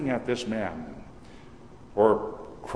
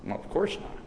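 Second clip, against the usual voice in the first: soft unvoiced thought, half whispered; inner thought; 2 semitones higher, 7 dB quieter than usual. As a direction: murmur it to yourself, smug. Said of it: "the man thinks".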